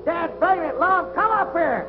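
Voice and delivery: high-pitched, exasperated call into the distance; urgent calling. Acted. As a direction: summon a friend urgently from afar. Calls from the tracks.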